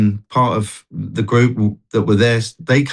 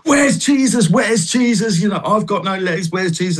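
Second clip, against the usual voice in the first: imitating an angry man, pitch pushed much higher; retold angry outburst; 7 semitones higher than usual. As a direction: replay his angry demand, voice jumping up high. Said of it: "he was really angry".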